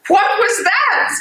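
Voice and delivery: jolted by gunfire, ask in alarm; scared cry after the gunshots; startled fear. Acted. Sudden gunshots outside.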